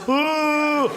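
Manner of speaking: a quick mock yawn. Shown as a demonstration. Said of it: "one person does this".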